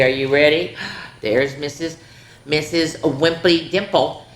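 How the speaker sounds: ordinary delivery, nothing marked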